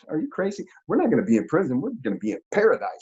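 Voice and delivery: high, amused disbelief brushing off her worry; scoffing reassurance to his crying girlfriend; incredulous. Retold or imitated. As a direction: laugh off her fear as crazy.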